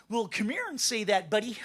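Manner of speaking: polite Canadian trying to sound hard; Canadian accent. Acted. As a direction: feeble tough talk in a Canadian accent. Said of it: "It totally sucks to sound like this".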